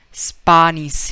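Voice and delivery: put-on "Spanish" as spahnish in a Spanish accent. Teacher imitating Spaniard, Spanish accent. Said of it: "he might would say like that".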